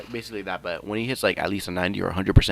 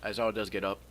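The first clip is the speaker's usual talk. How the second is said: ordinary delivery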